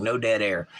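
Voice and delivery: ordinary talk, nothing marked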